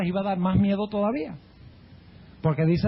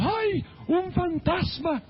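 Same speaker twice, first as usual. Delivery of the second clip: drawn-out, much higher frightened wail; terrified cry; 10 semitones higher, much slower than usual. Retold or imitated. Disciples in the storm cry out, a slow high terrified wail. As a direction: cry it out slowly, like seeing a ghost.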